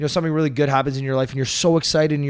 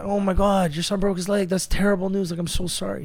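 lightly raised pitch, mock sympathy; neighbor's pity; 6 semitones higher than usual. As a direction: play the sorry neighbor, a bit higher. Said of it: "The neighbor comes by".

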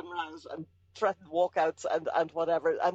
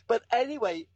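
normal talking voice

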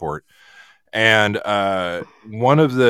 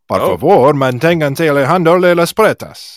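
Spanish transit announcer voice; louder formal PA announcement; train station announcement; 3 semitones higher, 7 dB louder than usual. Pretend.

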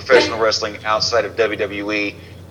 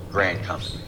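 ordinary talk, nothing marked

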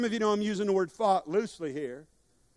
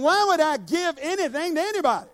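higher, louder protest; mock indignant; 7 semitones higher, 5 dB louder than usual. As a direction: ham up a stingy listener's outrage. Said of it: "You're thinking".

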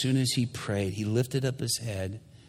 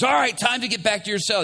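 high, loud barked order; guard's command; 12 semitones higher, 7 dB louder than usual. Retold or imitated. Guard ordering the prisoner back to his cell, loud. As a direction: call time like a prison guard.

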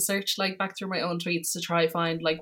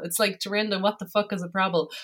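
ordinary talk

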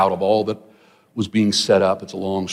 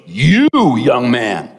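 drawn-out YOU, called out like a preacher; shouting preacher; 4 dB louder, much slower than usual. Retold or imitated. Preacher calling out a laugher in the back row, shouting. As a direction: imitate a preacher singling someone out, slow and emphatic. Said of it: "preach, shouting, preaching machine".

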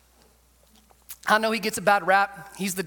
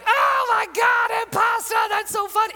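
hammed-up belly laugh; 12 semitones higher than usual. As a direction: fake a huge laugh, voice pitched way up. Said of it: "starts belly laughing".

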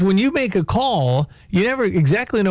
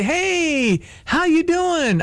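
higher, bright meet-and-greet hello; friendly greeter; 10 semitones higher, much slower than usual. Shown as a demonstration. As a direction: greet them like a cheery host. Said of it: "I can do that".

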